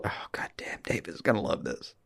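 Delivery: hushed, whispered inner thought; thought aloud. Retold or imitated. Co-host recalls his reaction to reading a scene, hushed thought. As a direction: whisper it to yourself, very soft and low. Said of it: "I read that scene and thought".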